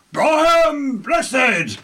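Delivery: a booming, thrilled Brian Blessed; delighted exclamation. Real, not acted. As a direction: shout Brian Blessed like a fan cheering. Joyful exclamation of the actor's name.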